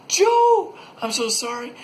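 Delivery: squeaky high, loud mock-grief wail; cartoonish high-pitched apology shouted at a fallen buddy; panicked soldier. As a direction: overplay the horror of stabbing your friend. Soldier apologizes to killed friend.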